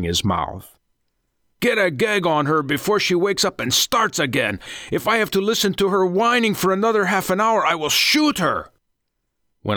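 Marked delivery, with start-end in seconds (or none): 1.6-8.7 high, hurried, irate threat; angry orders. A narrator's reading. Ringleader threatening to shoot the whining captive, angry. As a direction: order them about, out of patience.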